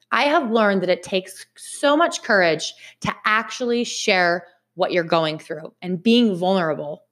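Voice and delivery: ordinary delivery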